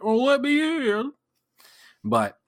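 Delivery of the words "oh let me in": high, whiny mock plea. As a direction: beg to be let in. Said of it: "I'm not one to be like".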